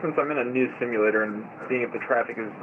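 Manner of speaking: tinny pilot voice over radio; radio transmission. Real, not acted. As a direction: talk like through a headset radio.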